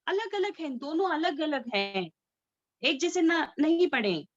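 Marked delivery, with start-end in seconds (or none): none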